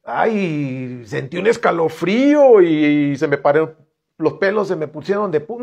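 hammed-up shiver of excitement; mock thrill. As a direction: play someone gushing about goosebumps. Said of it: "No es de que vas a sentir". (imitando con burla a alguien emocionado)

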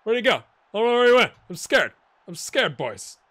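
loud, high mock panic; scared camera. The camera loses the vlogger, mock scared and loud. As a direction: squeal like a lost, frightened camera. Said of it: "the camera ... is like".

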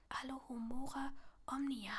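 hushed, secretive whisper; very soft whispered incantation; whispered spell. A narrator's reading. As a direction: whisper the incantation under your breath. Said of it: "flüsterte Neville".

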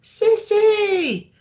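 very high, loud call into the dark; long called name. Acted. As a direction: shout her name across the water. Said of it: "Horace called".

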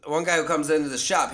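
ordinary talk, nothing marked

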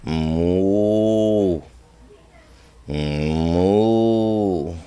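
low, drawn-out moo; playful low moo for baby; cow moo. Acted. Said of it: "And the cow says".

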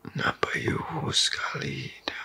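usual speaking voice

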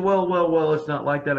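mock defensive doctor sputtering; flustered doctor. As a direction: play the caught-out doctor scrambling.

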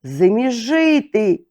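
high crow caw then a cry; crow's battle cry. A narrator's reading. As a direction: caw and charge into the fire. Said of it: "кинувся прямо на полум'я".

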